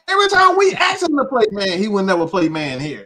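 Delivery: loud, high-pitched complaint; exasperated outburst. Real, not acted. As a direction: protest loudly in disbelief. Exasperated complaint about the coach.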